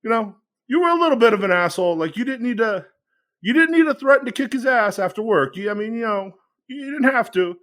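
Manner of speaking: a hint of a Goodfellas mobster, fast; De Niro mobster. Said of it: "like Robert De Niro from Goodfellas".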